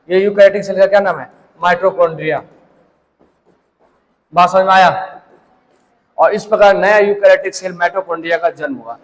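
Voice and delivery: normal talking voice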